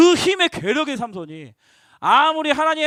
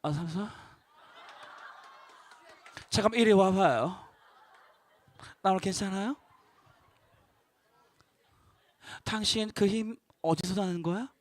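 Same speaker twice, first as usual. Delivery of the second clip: soft, lower, laughing seduction; sultry style; 4 semitones lower, 6 dB quieter than usual. Retold or imitated. Delilah luring Samson closer, soft and laughing. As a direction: play a languid temptress beckoning him over. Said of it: "뭐 그런 스타일이야".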